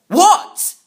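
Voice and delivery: "What?" yelled high and loud on a rising tune; startled loud what. Acted. Startled boy shouting "What?" and nearly alerting the guards. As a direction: blurt "What?" out loud, far too loud. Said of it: "I replied, nearly alerting the guards".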